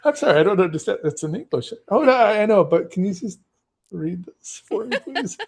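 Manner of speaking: high, pleading voice begging someone to read aloud; pleading tourist. Retold or imitated. Tourist begging a local to read to her, high and pleading. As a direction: coax them sweetly into reading. Acted exchange about reading English.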